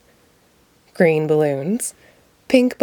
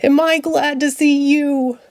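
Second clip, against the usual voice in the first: delighted; 6 semitones higher than usual. Acted. Ernie reunited with duck.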